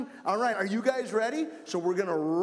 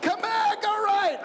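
much higher, louder calls to come back; calling out loud; 10 semitones higher, 4 dB louder than usual. Real, not acted. Calling kids back mid-game.

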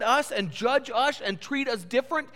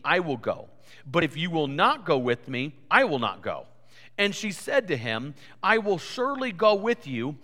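scripture reading; 6 semitones lower than usual. Acted. Pastor reading Judges to the congregation, measured reading voice. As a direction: read the verses steadily to the congregation.

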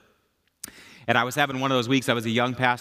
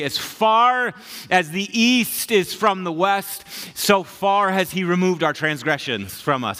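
raised, drawn-out proclamation; declaiming scripture; 6 semitones higher, 4 dB louder, slower than usual. Acted. Preacher quoting the psalmist on forgiveness, declaiming. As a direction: stretch it out high and slow, line by line.

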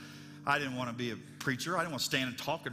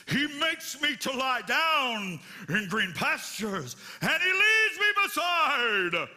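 hammed-up theatrical reading, much higher, louder and slow; overdramatic recitation; 5 semitones higher, 6 dB louder, much slower than usual. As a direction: declaim it like a grand stage actor. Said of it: "give it as much dramatic flair as you possibly can".

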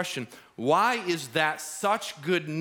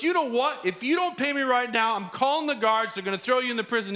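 high, loud threatening rant; mock threat; 9 semitones higher, 3 dB louder than usual. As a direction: threaten him, pushing up and faster. Servant threatens friend.

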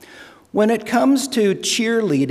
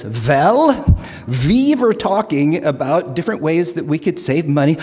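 put-on light Norwegian accent, rising to excited; 2 semitones higher than usual. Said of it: "fake a Norwegian accent in a joke".

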